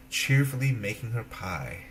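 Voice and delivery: plain reading voice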